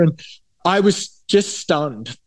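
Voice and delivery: ordinary talk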